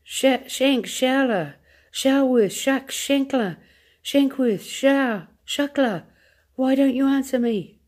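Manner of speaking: high, frantic calling of names; desperate calling. A narrator's reading. President calling his lost astronauts, high and frantic. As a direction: call into the dead radio in panic. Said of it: "cried the president".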